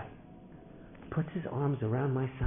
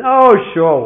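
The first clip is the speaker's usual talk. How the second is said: put-on loud, higher boyish burst of enthusiasm; brightened; 9 semitones higher, 17 dB louder than usual. Boy agrees to let Shai play, brightened and loud. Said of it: "he brightened".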